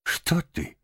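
high-pitched flustered questioning; alarmed. A narrator's reading. Rat startled by doctor request, alarmed. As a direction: fuss in sudden alarm. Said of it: "всполошился Крысси".

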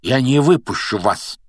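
very determined. A narrator's reading. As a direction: stand your ground firmly. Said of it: "был явно настроен очень решительно".

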